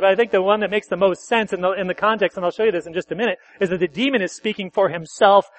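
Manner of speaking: normal talking voice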